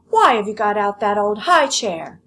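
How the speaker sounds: loud demanding shout. Acted. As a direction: bark the question at them. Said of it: "shouted Lolly".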